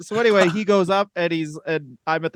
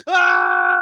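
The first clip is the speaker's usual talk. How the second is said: a short, sudden yelp of pain, much lower in pitch; mock pained cry. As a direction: cry out as if bleeding, half-joking.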